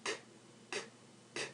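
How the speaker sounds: K as a bare consonant puff, no voice; unvoiced K. Shown as a demonstration. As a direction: say each K without using the vocal cords. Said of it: "K is an unvoiced consonant".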